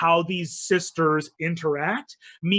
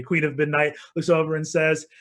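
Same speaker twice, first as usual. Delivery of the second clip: normal talking voice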